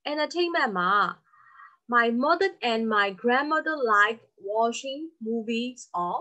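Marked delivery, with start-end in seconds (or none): 2.0-5.8 slow reading. Shown as a demonstration. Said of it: "complete the table".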